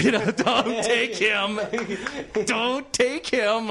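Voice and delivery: drawn-out plea. Retold or imitated. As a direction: drag each word out. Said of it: "like that".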